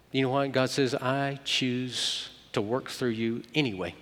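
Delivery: preaching comfort, slowed and softened; tender reassurance. Real, not acted. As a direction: comfort them gently, taking your time.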